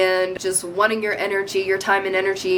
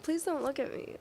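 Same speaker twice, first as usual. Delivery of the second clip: much softer and higher, shyly begging; shy plea; 5 semitones higher, 12 dB quieter, faster than usual. Pretend. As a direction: shrink away, pleading not to be looked at. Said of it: "I'm so shy right now".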